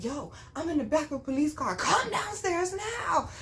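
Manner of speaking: high-pitched, insistent plea down the phone; urgent calling. Retold or imitated. Daughter phones mama from the police car, urgent. Urgent call to mother retold.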